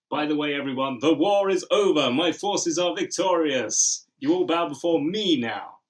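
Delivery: loud, slow, gloating villain; dark lord. Retold or imitated. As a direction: proclaim victory like a tyrant. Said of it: "It's like".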